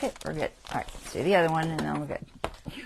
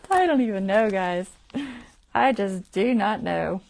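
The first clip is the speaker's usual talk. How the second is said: exasperated; 8 dB louder than usual. Real, not acted. Frustrated exclamation to viewers.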